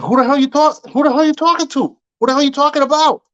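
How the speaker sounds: high, fast indignant retort; indignant outburst. Retold or imitated. Retold quarrel with rude girl.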